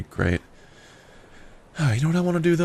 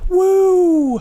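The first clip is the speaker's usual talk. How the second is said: much higher, louder whoop of joy; excited whoop; 9 dB louder than usual. Real, not acted. Cheering about finally driving alone, loud whoop. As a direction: let out a big happy woo.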